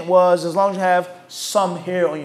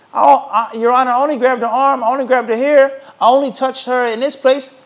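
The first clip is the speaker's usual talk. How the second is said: raised, louder whiny excuses; pleading accused man; 5 semitones higher, 5 dB louder than usual. Acted. Accused man pleading with the judge, louder and higher. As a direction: protest your innocence, a bit desperate.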